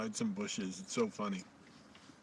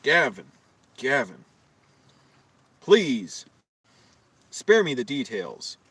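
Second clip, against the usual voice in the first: exasperated; 9 dB louder, slower than usual. Retold or imitated. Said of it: "I'm like".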